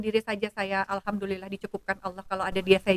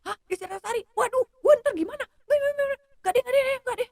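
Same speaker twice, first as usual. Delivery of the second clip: much higher, frantic refusal; panicked man; 12 semitones higher than usual. Retold or imitated. The blind date refusing to meet her, high and frantic. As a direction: back out in a panic.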